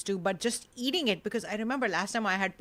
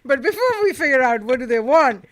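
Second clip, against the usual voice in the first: dramatic announcer; 4 semitones higher, 8 dB louder than usual. Acted. Dramatic show teaser.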